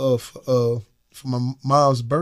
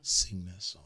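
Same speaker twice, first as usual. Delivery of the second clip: hushed advice; 4 semitones lower, 13 dB quieter than usual. Retold or imitated. Quiet advice to fellow celebrities.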